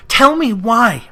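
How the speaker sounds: slow, loud frightened plea; touch of fear. Acted. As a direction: ask with a tremor of dread.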